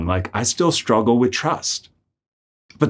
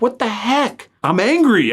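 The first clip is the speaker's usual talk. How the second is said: frustrated outburst; 11 semitones higher than usual. Retold or imitated.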